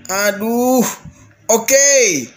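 ordinary delivery

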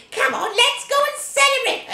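high, eager witch call; gleeful witch. Acted. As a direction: urge her sister off to party. Witch calls sister to celebrate.

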